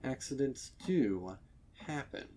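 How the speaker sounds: ordinary delivery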